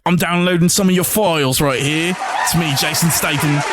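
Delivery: lightly put-on British accent for Statham; a cheeky London bloke, British accent; mock British accent. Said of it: "no, he's British".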